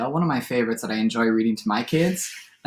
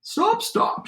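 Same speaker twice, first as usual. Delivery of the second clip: high, half-voiced call to halt; palomino shout. A narrator's reading. Palomino stallion rears at the cowboy, high mild shout. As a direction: cry stop high without much volume. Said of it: "shouted the palomino stallion".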